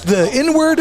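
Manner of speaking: very high, mock-innocent question; grandma's surprise. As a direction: play grandma's puzzled surprise. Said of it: "And she goes".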